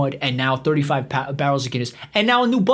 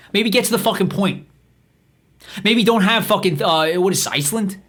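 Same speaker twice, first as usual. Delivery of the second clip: normal talking voice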